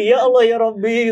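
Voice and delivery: high, loud mock-tearful plea to God; retold tearful prayer. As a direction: re-enact praying through tears, hammed up. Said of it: "Doa lagi". (menirukan doa sambil menangis, bernada tinggi)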